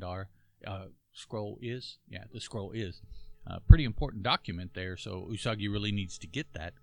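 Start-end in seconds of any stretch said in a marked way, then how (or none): none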